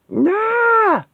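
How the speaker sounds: high, raw battle roar; rage roar. Acted. She-Hulk charges the robot with a roar. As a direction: let out a furious roar.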